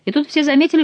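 usual speaking voice

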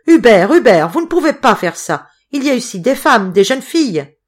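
loud, high-pitched imploring shout; desperate plea. A narrator's reading. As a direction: cry out to stop him. Mayor begs as fire threatens. (supplication criée, affolée)

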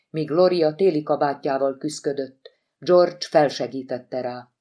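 plain reading voice

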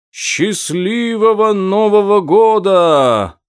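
high, loud, drawn-out shout. A narrator's reading. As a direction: call it out from far below. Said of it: "прокричал голос далеко внизу".

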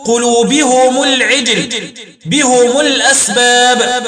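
"قلوبهم العجل بهم الأسباب" linked with -humu; slow recitation, u after h and m in "قلوبهم" and "بهم"; damm ha and mim. Shown as a demonstration. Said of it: "بضم الهاء والميم وصلا".

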